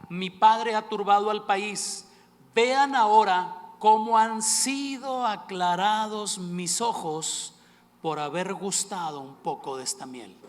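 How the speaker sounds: slow, loud declamation of scripture; lectura bíblica. Acted. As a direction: proclaim the verse, stretching the words. Reads Jonathan's words from scripture. (lectura declamada, lenta y enfática, de la Escritura)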